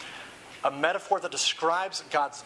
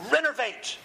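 cry; 9 semitones higher than usual. A narrator's reading. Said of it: "he cried".

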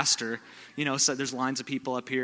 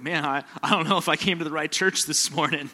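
laughing, higher imagined visitor; laughing mock worry; 5 semitones higher, 7 dB louder than usual. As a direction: voice the newcomer through laughter. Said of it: "you're like".

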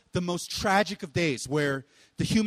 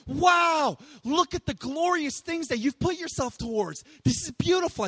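awed exclamation; 8 semitones higher than usual. Retold or imitated. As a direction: gasp at the glorious work, lifted high. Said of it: "come join and say".

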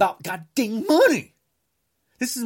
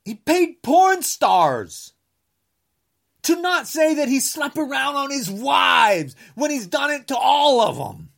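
angry shout; 6 semitones higher than usual. Real, not acted. Accusing Trump of paying off porn stars, angry shout. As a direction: yell the accusation.

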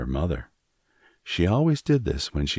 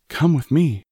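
panicked cry; 11 semitones higher, 6 dB louder than usual. A narrator's reading. Fleeing mother calls in panic.

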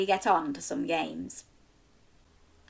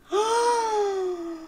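dramatic gasp; 12 semitones higher than usual. Acted. Gasp after reveal.

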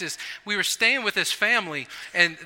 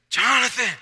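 calling louder; 4 semitones higher, 4 dB louder than usual. Retold or imitated.

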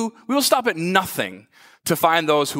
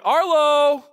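calling out; 9 semitones higher, much slower than usual. Retold or imitated. Father calling lost son louder.

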